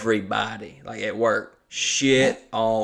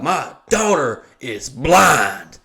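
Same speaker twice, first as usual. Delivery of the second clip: slow and louder, every word hammered; retold furious retort; 2 semitones higher, 6 dB louder, much slower than usual. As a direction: bite out each word like a furious father. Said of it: "old dude's like".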